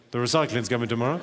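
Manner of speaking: mock her offhand bedroom remark; mimicking girlfriend. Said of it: "more accurately".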